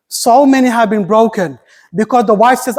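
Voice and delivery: ordinary delivery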